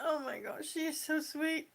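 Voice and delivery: very soft, overcome; tender and close to crying; quietly welling up; holding back tears. Real, not acted. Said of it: "I'm not crying".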